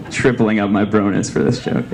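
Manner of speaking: usual speaking voice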